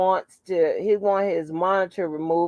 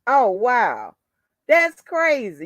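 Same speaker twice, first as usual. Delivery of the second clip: ordinary delivery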